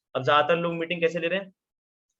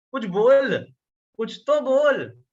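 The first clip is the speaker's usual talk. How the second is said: louder and higher, dragging out slowly; exasperated plea; 6 semitones higher, 6 dB louder, much slower than usual. Retold or imitated. Seller frustrated at a silent prospect, exasperated drawn-out half shout. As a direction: stretch each word in mock frustration, half shouting.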